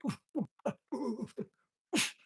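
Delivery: high hooting jungle calls; mimicked jungle call. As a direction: imitate short hoots from the jungle. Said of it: "walked in the jungle now and went".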